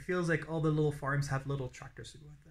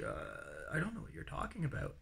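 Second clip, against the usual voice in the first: confused host; 10 dB quieter than usual. Retold or imitated. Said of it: "he looks at me like".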